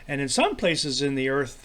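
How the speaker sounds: ordinary talk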